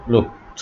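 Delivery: "লুকড" said as "lookt"; final -ed as T. Shown as a demonstration. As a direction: finish "লুকড" on a voiceless t. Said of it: "ইডির উচ্চারণ হবে ট". ("লুকড"-এর শেষে ট উচ্চারণ)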